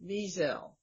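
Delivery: Wiesel with the weight on VEE, VEE-zel; first syllable stressed. Shown as a demonstration. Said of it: "I try to emphasize the first syllable".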